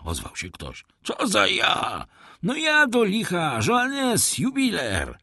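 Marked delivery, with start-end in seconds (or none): 1.0-2.1 high, drawn-out challenge; calling through door. A narrator's reading. Husband to the unknown voice outside, high challenge. 2.4-5.2 high-pitched exasperated call; impatient call. A narrator's reading.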